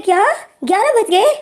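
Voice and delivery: चौंककर, ऊँची आवाज़ में; झटके से ऊँचा और तेज़ सवाल; shocked. Acted. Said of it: "wing shock में हो जाता है". (अचानक समय देखकर हैरान विंग, ऊँची चौंकी आवाज़)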